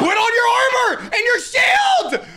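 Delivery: high, loud, drawn-out warning; urgent shout. Real, not acted. Player warns partner before a spawn, urgent shout. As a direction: shout frantic orders at your teammate.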